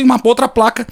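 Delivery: usual speaking voice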